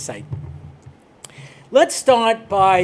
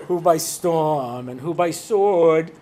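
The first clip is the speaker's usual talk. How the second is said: solemn recitation; 4 semitones lower, much slower than usual. Retold or imitated. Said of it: "reading the prayer".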